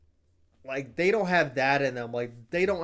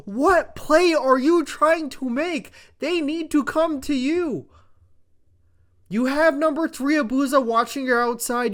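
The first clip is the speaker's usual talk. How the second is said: much higher, loud, incredulous shouting; furious yelling; 8 semitones higher, 6 dB louder than usual. Real, not acted. Ranting at Hook's play in a four v three, furious yelling. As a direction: yell in total disbelief at the play. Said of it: "I was so fucking furious watching this".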